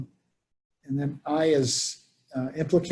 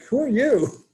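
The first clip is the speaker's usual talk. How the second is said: puzzled question; 9 semitones higher, 3 dB louder than usual. Retold or imitated. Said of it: "he's like".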